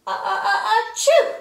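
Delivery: gasps then a high loud achoo; sneeze. Acted. Doctor Cat catching the sneeze, achoo. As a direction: gasp and sneeze hard.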